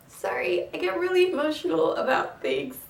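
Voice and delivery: apologizing while welling up; tearing up. Real, not acted. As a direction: voice lifted high, choked with real emotion. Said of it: "see me tear up".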